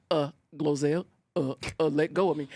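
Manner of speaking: a hint of the president. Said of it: "he's like".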